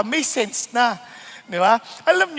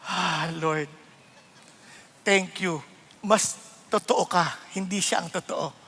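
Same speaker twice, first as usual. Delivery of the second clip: calm prayer; 7 semitones lower, 7 dB quieter than usual. Retold or imitated. Calm prayerful reply to insult.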